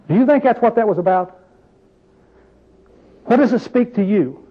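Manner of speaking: ordinary talk, nothing marked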